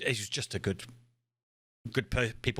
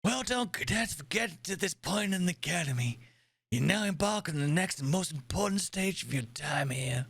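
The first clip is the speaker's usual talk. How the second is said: lightly voiced old American admiral; a hint of a gruff old doctor; old man American voice; 6 semitones higher than usual. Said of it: "how do I do an old man American voice".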